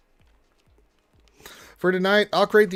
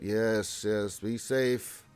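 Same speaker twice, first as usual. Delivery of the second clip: ordinary delivery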